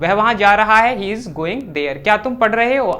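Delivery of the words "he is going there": very slow, word by word for the class; slow dictation. Shown as a demonstration. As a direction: spell it out slowly so students can write. Teacher dictating English sentence slowly. (बहुत धीरे, शब्द-दर-शब्द बोलना)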